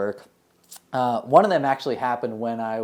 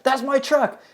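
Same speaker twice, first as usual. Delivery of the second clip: high, loud alarmed cry; shouting; 13 semitones higher, 5 dB louder, slower than usual. Retold or imitated. Patient realizing his truck is being robbed, shouting high. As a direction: blurt it out in alarm.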